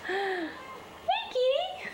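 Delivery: high, bright call to the cat; high call to a kitty. Real, not acted. Owner calling the kitty, high and sweet.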